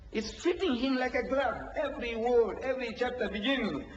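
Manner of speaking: ordinary talk, nothing marked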